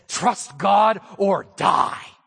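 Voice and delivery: slow, emphatic, punched out; blunt motto. Retold or imitated. A son quoting his dad's motto, slow and emphatic. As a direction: hammer each word home. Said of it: "My dad says".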